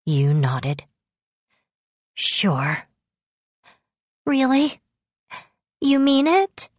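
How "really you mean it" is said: high, eager double question; hopeful disbelief. A narrator's reading. Girl checking that Yu really agrees, hopeful disbelief. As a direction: rise high, hoping it is true.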